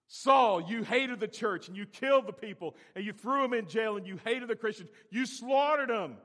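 loud, high rebuke; preacher voicing Jesus rebuking Saul; accusing Saul. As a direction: call out Saul's sins.

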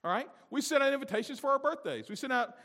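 loud, high, driving sermon voice; preaching at full force. Acted. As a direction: whip the congregation up.

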